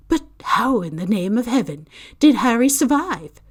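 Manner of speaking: astounded, struggling to grasp it; overwhelmed amazement. A narrator's reading. McGonagall marvels to Dumbledore at Harry's survival, astonished.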